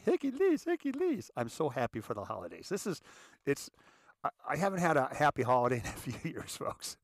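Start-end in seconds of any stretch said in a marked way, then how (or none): 0.0-1.3 very high comic squeal; Robin Williams, Eddie Murphy. Acted. Showing off his happy voice, high zany comic impression. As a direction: pitch it way up as a zany celebrity impression. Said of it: "doing a little Robin Williams, a little bit of Eddie Murphy".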